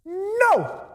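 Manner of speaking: high, drawn-out mock shout; shouting back. Imagined Lazarus answering Jesus, shouting back. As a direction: shout a long refusal from far off. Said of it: "shouting back".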